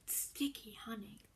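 ordinary delivery, nothing marked

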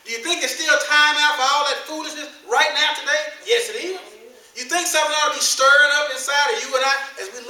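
loud, high, building sermon; climbing preacher's call; full force preaching. Acted. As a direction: drive it home to the congregation.

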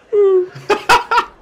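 high, loud mock sobbing hoots; hammed-up ape sobs; imitated gorilla crying. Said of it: "you could hear it crying".

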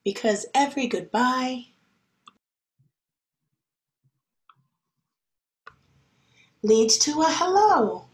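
gentle picture-book reading voice; storybook reading. Acted. Teacher reading a picture book to her class, gentle.

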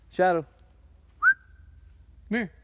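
loud, high call across the woods; calling the dog. Real, not acted. Hiker calling his dog Shadow to come over.

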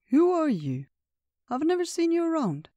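put-on an old creature's surprised curiosity, high; old bear voice. Old Bear asks Tina.

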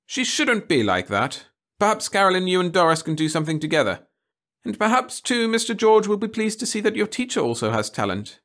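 a prim teacher coaxing her pupil; a hint of a fussy schoolmistress. A narrator's reading. Teacher Miss Burton speaking.

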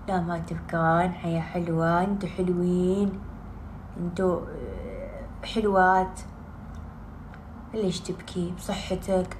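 tender low consolation; soothing. Real, not acted. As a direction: gently reassure those crying. Soothing herself and viewers.

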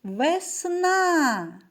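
весна called out with a long, high second syllable on -на; calling out the word. Shown as a demonstration. Teacher calling the word so pupils hear the stress, sing-out весна. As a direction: call весна, stretching the stressed final а. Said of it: "покличемо".

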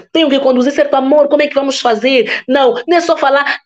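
normal talking voice

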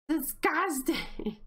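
drawn-out, appalled disgust. Real, not acted. Player appalled at the filthy house, disgusted. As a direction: draw the word out in disgust.

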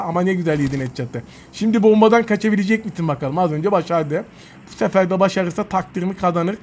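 normal talking voice

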